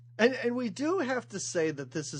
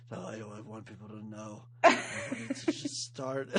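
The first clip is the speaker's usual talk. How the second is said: Snake impression; 9 semitones lower than usual. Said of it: "Amazing snake imitation".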